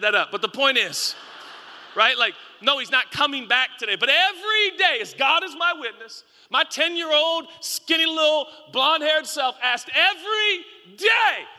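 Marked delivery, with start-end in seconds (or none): 4.0-11.5 high and loud, building with passion; excited preaching shout. Real, not acted. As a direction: belt out every day like it matters.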